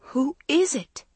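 high and shrill with fear; high fearful voice. Acted. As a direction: call out, terrified. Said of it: "her fear making her voice high and shrill".

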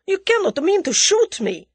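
high, loud terrified protest; Russian coward shrieking in disbelief; shrieked. A narrator's reading. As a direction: plead shrilly in panic. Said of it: "shrieked the Russian".

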